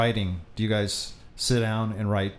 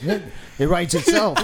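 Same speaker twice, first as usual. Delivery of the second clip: put-on heavy New York accent, higher pitch; 4 semitones higher than usual. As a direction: say it like a New Yorker. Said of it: "do it in New York accents".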